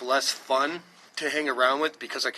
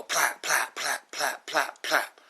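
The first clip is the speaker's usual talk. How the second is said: gunshot noises; 2 semitones higher, much slower than usual. Retold or imitated. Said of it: "So you go".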